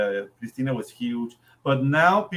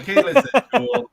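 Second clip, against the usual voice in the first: laughing; 10 semitones higher, 7 dB louder than usual. Real, not acted. Laughing defense.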